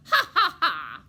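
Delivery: loud high cackling ha-ha; evil chuckle. Shown as a demonstration. Reader showing children an evil chuckle. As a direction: cackle like a cartoon villain. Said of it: "Let's hear your evil chuckle".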